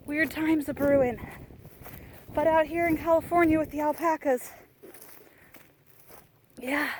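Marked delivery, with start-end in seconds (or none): none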